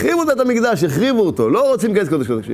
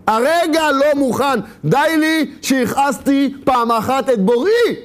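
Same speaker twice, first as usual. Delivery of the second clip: high, slow, insistent cry; impassioned refusal; 6 semitones higher, slower than usual. Acted. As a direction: plead it out high, drawing out each word. Penitent cries out the refusal.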